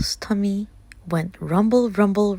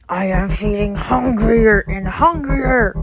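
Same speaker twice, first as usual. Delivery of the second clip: slow, louder wolf roar; 5 dB louder than usual. Acted. Hungry wolf roaring in grandmother's house, loud. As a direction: growl out the hunger. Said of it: "roared the wolf".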